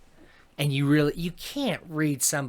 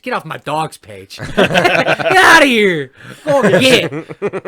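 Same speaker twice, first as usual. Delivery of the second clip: slow, high laughing shout; 7 semitones higher, 13 dB louder, slower than usual. Acted. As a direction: yell it out through laughter. Mock scolding Facebook commenters.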